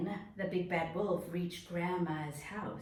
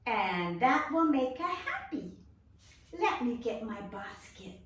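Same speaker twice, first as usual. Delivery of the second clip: put-on higher, cheerful little girl; 7 semitones higher, 5 dB louder than usual. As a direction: play the girl for the kids.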